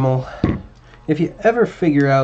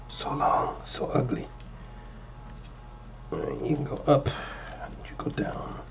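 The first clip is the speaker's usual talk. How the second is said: whispering; 13 dB quieter than usual. Real, not acted. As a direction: mutter it to the wires in a whisper. Muttering at wires while working.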